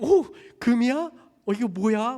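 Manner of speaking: high, loud delighted exclaim; thrilled at the gold, voice up; delighted gasp. Acted. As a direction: neighbours marvelling at a gift, high and loud. Neighbours admiring gold.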